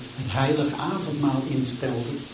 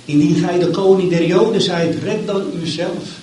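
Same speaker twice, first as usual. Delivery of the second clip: mocking; 5 semitones higher, 6 dB louder than usual. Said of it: "om hem te bespotten".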